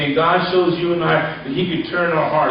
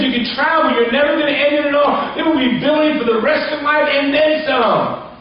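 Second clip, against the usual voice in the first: rising excitement; 9 semitones higher than usual. Real, not acted. Preacher building to climax.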